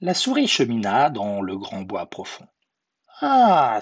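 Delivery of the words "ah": high, loud "ah" of a hungry snake; Schlange. A narrator's reading. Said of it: "se dit un serpent".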